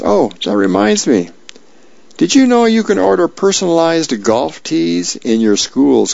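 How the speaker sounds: lightly voiced breezy Dagwood. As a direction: chirp it up as if nothing happened. Dagwood changes subject in comic.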